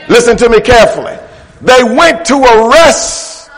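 forceful pulpit climax; preacher rousing the congregation; emphatic preaching. Acted. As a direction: drive the phrases home, stretching the key words.

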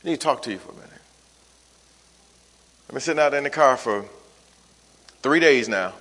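soft, low, composed confession to a spouse; calm, weary tone. Shown as a demonstration. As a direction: keep the voice down, tired but calm. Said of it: "this is the tone you wanna use".

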